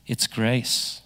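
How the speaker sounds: soft, quiet "grace" with a smile; smiling C S Lewis. Retold or imitated. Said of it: "Lewis puts on a big smile".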